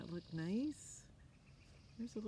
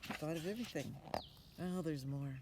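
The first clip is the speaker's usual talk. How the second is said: ordinary delivery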